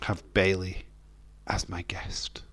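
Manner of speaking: breathy whisper; whispered aside. Real, not acted. Streamer whispering about his video guest. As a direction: drop to a secret whisper.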